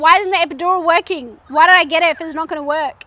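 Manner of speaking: retold quick annoyed outburst; frustrated complaint. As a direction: demand an answer, fed up. Complaining the epidural failed.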